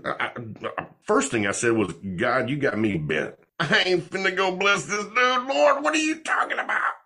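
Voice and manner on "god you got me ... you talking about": squeaky high outraged pleading; incredulous protest. Retold or imitated. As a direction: refuse in comic disbelief, voice climbing. Protesting God's command.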